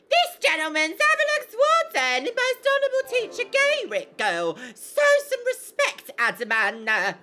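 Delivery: very high, loud, scolding character voice; indignant game character. Acted. Game character rebukes Adaman for disrespect, high indignant voice. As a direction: shriek it haughtily, drawing out the words. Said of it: "let me get into character".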